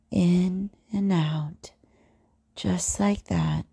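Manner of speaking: slow, hushed coaxing; slow whisper. Acted. Domme guiding her partner, slow whisper. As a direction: whisper it slowly, drawing it out.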